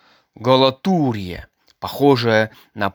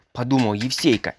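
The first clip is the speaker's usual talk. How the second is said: plain reading voice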